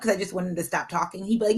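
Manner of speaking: ordinary talk, nothing marked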